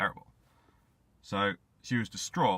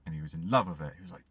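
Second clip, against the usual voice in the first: plain reading voice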